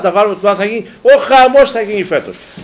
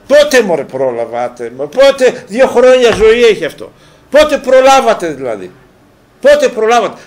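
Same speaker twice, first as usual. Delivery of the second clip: higher, louder exasperated protest; indignant; 4 semitones higher, 4 dB louder than usual. Real, not acted. Host protesting the cup scrapped after two years, indignant. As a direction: burst out in exasperated disbelief, pitched up.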